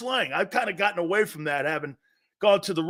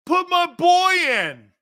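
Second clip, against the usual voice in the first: high, slow shout; drawn-out fan yell; demanding shout; 8 semitones higher, 6 dB louder, slower than usual. Acted. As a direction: yell it at the coach from the stands.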